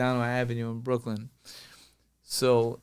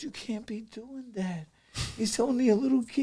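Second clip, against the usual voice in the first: protective mom; 9 semitones higher, 6 dB quieter than usual. Retold or imitated. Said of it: "He goes".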